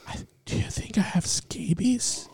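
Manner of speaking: whispered imitation of a nervous man asking; hushed worried client. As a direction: lean in and whisper it like an embarrassed customer. Retelling a worried client's question.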